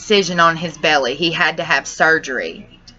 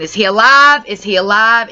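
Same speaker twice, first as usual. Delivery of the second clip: higher, insistent repeated plea; retold frantic questioning; 4 semitones higher, 5 dB louder than usual. Begging to hear the dog is alive, retold frantic pleading. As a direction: re-enact desperate asking, short of a scream. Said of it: "That's what I'm asking".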